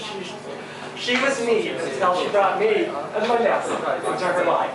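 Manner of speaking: normal talking voice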